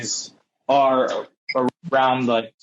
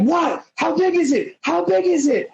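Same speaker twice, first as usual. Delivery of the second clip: excited; 12 semitones higher than usual. Retold or imitated. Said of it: "And Luke's like".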